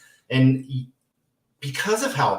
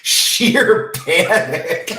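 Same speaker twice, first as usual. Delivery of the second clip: loud, high, through laughter; helplessly amused, louder as it goes; laughing while talking; 4 semitones higher, 10 dB louder than usual. Real, not acted. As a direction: talk through a real laugh, voice rising. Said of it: "I'm laughing".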